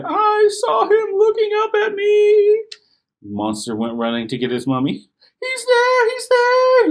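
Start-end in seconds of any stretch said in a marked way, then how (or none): 0.0-2.6 very high, loud panicked shouting; boy fleeing to his mom; terrified cry. Acted. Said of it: "he cried". 5.4-6.9 very high, loud panicked shout. Acted. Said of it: "he shouted".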